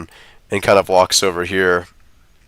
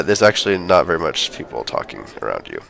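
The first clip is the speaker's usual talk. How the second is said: ordinary talk, nothing marked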